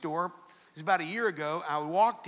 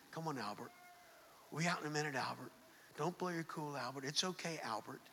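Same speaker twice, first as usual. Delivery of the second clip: put-on soft murmured calming to the boy; under his breath; 4 semitones lower, 11 dB quieter, faster than usual. Dad murmuring to his fussy son in the store, soft and low. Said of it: "just under his breath".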